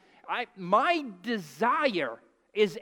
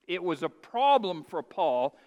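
normal talking voice

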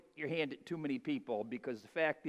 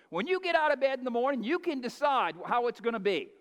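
higher and louder, driving each point home; fired-up preaching; 7 semitones higher, 5 dB louder than usual. Acted. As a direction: preach it like a rally cry.

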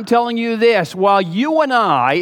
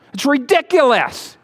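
ordinary talk

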